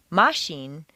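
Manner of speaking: Machine stressed on the first syllable with a rise; wrong stress. Shown as a demonstration. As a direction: say MA-chine the wrong way. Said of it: "is hardly recognizable as machine".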